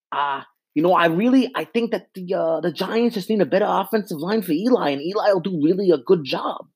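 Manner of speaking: put-on old sports radio caller. Fan mocks old Long Island callers on sports radio, lightly voiced. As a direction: play a clueless caller from Brooklyn dialing in. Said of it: "You get the old guys from, from Long Island or fucking Brooklyn. They're like".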